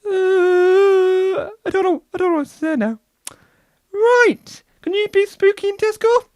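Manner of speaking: high, bemused chairman; puzzled, high pompous voice; chairman ghost. Pretend. Chairman puzzled by the Tesco wish, high bemused voice.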